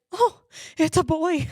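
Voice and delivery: high, breaking into a laugh; retold surprise. Mother reacting to the news, retold.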